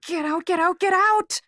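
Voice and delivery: rising from plea to shout; building panic into a yell; get out. Acted. Girl screams at the voice in her head, building to a shout.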